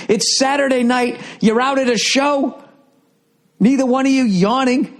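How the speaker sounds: high, strained disbelief at the couple; incredulous rant. Real, not acted. Comic ranting at a smug couple, voice pitched high in disbelief. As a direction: voice climbing high in outrage.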